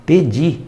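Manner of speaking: "Pedi" stressed on the final i; past tense pedi. Shown as a demonstration. Teacher naming the past tense form "Pedi". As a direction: say "Pedi" with the weight on the end. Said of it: "pretérito perfeito do verbo pedir".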